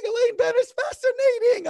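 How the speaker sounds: squeaky high comic character voice; high put-on voice. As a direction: jump way up into a silly voice. Seinfeld-style joke line.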